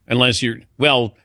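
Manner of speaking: usual speaking voice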